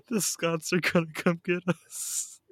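high, drawn-out mock dread; mock alarm. Podcaster joking that Scots will retaliate, mock alarm. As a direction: wail it slowly, half laughing.

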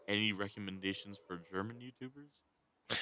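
softly spoken question, hard to hear; too quiet. Real, not acted. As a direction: ask it softly, under normal volume. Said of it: "Why are you getting so quiet?".

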